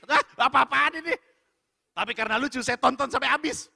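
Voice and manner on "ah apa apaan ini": high, loud, incredulous burst; baffled outburst. Retold or imitated. Viewer reacting to a strangely dubbed film, loudly baffled. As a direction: exclaim in mock disbelief.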